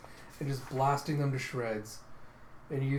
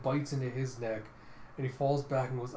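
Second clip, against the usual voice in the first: ordinary delivery, nothing marked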